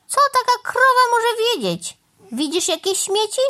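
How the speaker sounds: high-pitched huffy crow; indignant scoffing. Acted. Crow offended by the cow's accusation, indignant scoffing.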